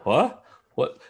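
high, slow, stunned disbelief; stammering in shock at a sudden turn. Retold or imitated. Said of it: "I was like".

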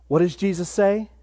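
usual speaking voice

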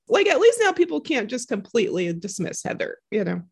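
usual speaking voice

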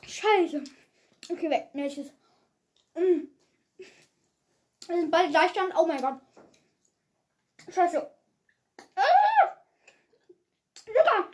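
talking through a sour mouthful; cringing at the sourness; eating sour candy. Real, not acted. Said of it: "Es ist so sauer".

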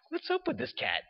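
high, bewildered mock wonder; baffled disbelief. Seminarian baffled by the repeated blessing, high incredulous. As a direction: act totally puzzled by the odd priest.

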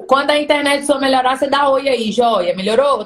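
loud, carrying call to a bad connection; to someone hard of hearing. Real, not acted. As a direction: raise your voice so they hear you. Calling to guest with bad connection.

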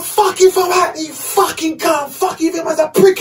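screeched high, losing control; furious cussing. Retold or imitated. To the man at the shower, a raging high yell. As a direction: cuss him out, pitch way up. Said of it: "I, I lost it".

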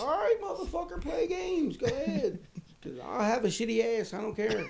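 high, whining mock warning; mock whiny threat. Desperate prisoner warning the cop he'll soil himself, high whine.

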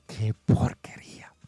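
slow, half-whispered disgust; disgusted aside. Acted. Host voicing a viewer's reaction to bad booking, slow disgusted whisper. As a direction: drag it out under the breath.

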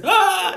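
high, loud mock horror cry; loud, silly heckler sound effect; imitated Grudge noise. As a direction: blurt out the movie ghost's noise.

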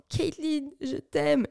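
high-pitched hammed-up love cry; mock yell. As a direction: cry it out like a cheesy film hero. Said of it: "il la rattrape en hurlant".

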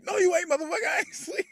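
very high squeaky mock retort. Retelling the brothers' quarrel to friends, squeaky mock retort. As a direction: squeal it, half laughing. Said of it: "acted quarrel between movie brothers".

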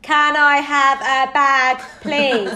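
loud, slow, word by word; over-enunciated Brit-abroad English. Acted. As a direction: talk to someone who barely understands English. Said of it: "speak slower and louder till someone understands".

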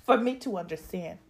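ordinary delivery

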